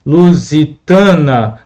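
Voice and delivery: slow, syllable by syllable; lu-si-ta-na, stretched slowly with a falling end; syllables spelled out. Shown as a demonstration. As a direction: draw out each syllable of lusitana. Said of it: "qual é a sílaba mais forte?".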